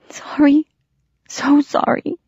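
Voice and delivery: high breathy whisper through tears; barely voiced, cried-out apology; tearful whisper. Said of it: "I murmured again".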